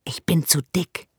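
breathless whisper, stuck in the bars; whispered worry. Acted. Branko whispers, stuck in the bars. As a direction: whisper it, worried you're too fat to fit.

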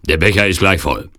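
loud henchman calling out a warning; Turkish henchman. Acted. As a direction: report it loudly to the princess. Turk keeps calling out his report. (lauter, warnender Türke)

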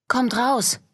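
put-on a nervous girl calling into the house. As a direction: call out to the hidden intruder. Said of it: "rief Lou".

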